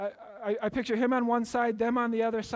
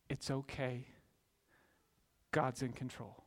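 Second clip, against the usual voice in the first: much softer and lower reassurance; gentle comfort; 7 semitones lower, 10 dB quieter than usual. Retold or imitated. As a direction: soothe frightened brothers. Joseph comforting frightened brothers.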